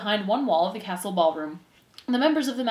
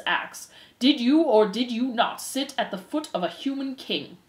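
preside with weighty, deliberate authority; stern head mouse; 3 semitones higher, 3 dB louder than usual. Acted. Head mouse puts rumors to the accused, stern and formal.

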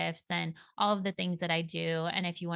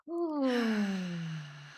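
long sighing exhale. Shown as a demonstration. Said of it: "take a nice deep breath".

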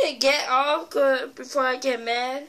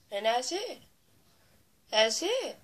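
ordinary talk

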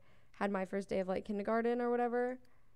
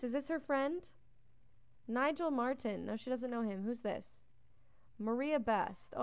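usual speaking voice

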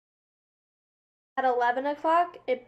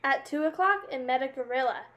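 usual speaking voice

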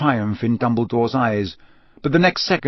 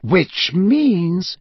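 deliberate, higher-pitched and measured; slow, leading lead-in; slowly; 6 semitones higher than usual. A narrator's reading. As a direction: draw the words out, leading to a conclusion. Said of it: "said Dumbledore slowly".

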